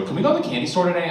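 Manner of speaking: put-on high, fast whiny kid voice; kids asking. Kids begging dad for candy store, high fast whine. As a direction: rattle it off like an impatient child.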